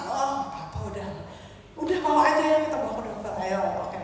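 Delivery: soft, easygoing reassurance; calm husband. Retold or imitated. Husband agreeing to go to the doctor, calm. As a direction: imitate a husband agreeing quietly and unhurriedly. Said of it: "Dia tenang".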